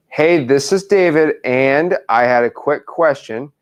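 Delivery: slow, measured dictation; dictating. Shown as a demonstration. Coach rewriting the script with trainee, slow dictation. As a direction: give them time to write each word.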